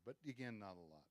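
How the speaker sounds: normal talking voice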